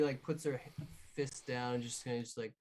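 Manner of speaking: normal talking voice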